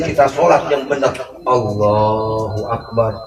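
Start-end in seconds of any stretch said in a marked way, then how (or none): 1.5-3.1 prayer recitation. Shown as a demonstration. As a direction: recite the takbir gently, like in prayer.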